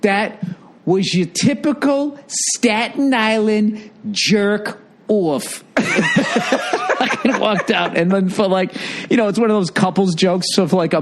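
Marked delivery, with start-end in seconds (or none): 0.0-5.6 put-on higher, drawn-out Staten Island accent. Woman storming out of the club, thick mock Staten Island accent. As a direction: imitate a Staten Island woman, stretching the words. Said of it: "with that accent".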